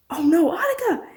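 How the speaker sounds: sudden high cry of alarm; alarmed gasp. Acted. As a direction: realize the danger all at once, pitch jumping up. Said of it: "Cameron gasped".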